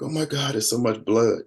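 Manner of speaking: high-pitched retold distress; imitating tearful wife. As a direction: voice lifted as if fighting back tears. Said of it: "she was trying to fight back tears".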